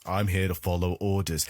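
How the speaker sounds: low, mock dutiful soldier; The Rock. Podcaster imitating the order-following soldier, low deadpan delivery. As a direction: drop low like a soldier on duty. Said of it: "who is just following orders".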